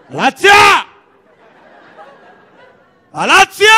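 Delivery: high, loud yell of a name; shouting. Acted. Ezequiel calling Galaxia over the party music, high loud shout. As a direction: holler the name across a noisy party. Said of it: "y empieza a gritar".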